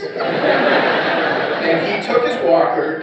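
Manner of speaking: slow, loud, through laughter; can barely speak for laughing. Real, not acted. Laughing while telling dad story.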